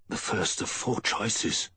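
slow, airy, dazed voice. A narrator's reading. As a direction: begin slowly, as if stunned. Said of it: "he began in a dazed voice".

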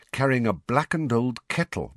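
ordinary delivery

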